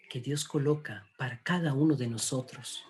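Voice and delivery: ordinary talk, nothing marked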